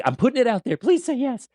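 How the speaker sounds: high, hopeful plea; mock pleading. Retold hopeful inner plea to co-host.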